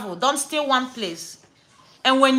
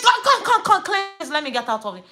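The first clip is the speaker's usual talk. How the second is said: retold exasperated outburst; 3 semitones higher, faster than usual. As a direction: re-enact losing patience completely. Retold quarrel escalates, she lost it.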